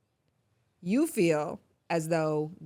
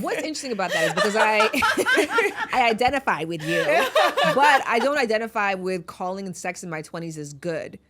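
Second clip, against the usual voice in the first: louder, laughing start; 3 semitones higher, 6 dB louder than usual. Real, not acted. Host laughing as she admits she can't call twenties sex good. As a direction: laughs her way into the point.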